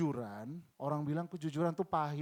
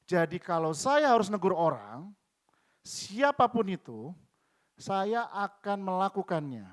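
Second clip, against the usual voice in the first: loud, slow, deliberate vow; firm declaration; 3 semitones higher, 7 dB louder, slower than usual. Acted. Preacher vowing to rebuke anyone, loud and slow. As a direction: land each word like a vow. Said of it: "declares resolve".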